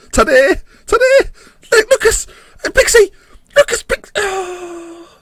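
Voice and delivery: very high-pitched. Real, not acted. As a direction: voice climbing to a squeal with excitement. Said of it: "the more high-pitched I get".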